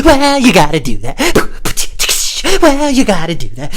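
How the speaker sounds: high, loud goofy outburst; put-on high, loud voice; goofy catchphrase voice. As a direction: blurt it like a cartoon catchphrase. Running catchphrase bit in put-on voice.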